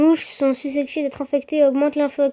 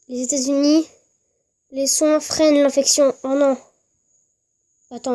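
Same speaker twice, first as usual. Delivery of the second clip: ordinary talk, nothing marked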